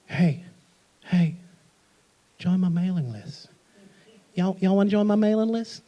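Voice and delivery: soft, lightly voiced creepy coaxing; creepy come-on. Speaker mocks sign-up forms, soft creepy coaxing. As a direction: lean in like a slightly creepy stranger. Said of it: "A little creepy".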